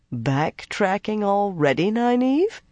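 slow, sneering taunt; derisive sneer. A narrator's reading. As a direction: sneer it, rising at the end. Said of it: "managed a derisive sneer".

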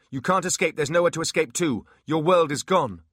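loud, high stern warning; hard officer warning; loud command. Acted. Holly commands demon.

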